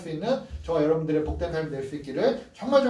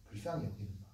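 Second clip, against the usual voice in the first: much lower and much softer; hushed repetition; 10 semitones lower, 15 dB quieter, faster than usual. Shown as a demonstration. Preacher echoing the key phrase softly to the congregation. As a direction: drop to a near whisper on the phrase.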